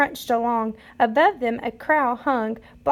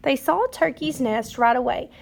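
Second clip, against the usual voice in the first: ordinary delivery, nothing marked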